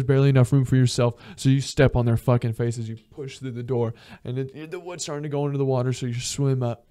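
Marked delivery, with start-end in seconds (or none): none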